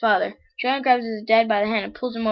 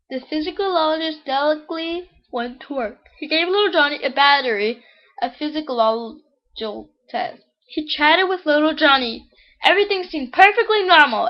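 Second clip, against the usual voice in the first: higher-pitched, measured read-aloud voice; joke storytelling; 6 semitones higher, 5 dB louder than usual. Acted. Reads the next joke aloud.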